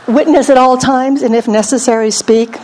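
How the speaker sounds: usual speaking voice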